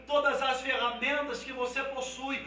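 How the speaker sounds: building from a strong call to a high, shouting climax; fiery altar-call crescendo; preaching at full force. Acted.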